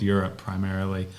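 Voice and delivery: ordinary delivery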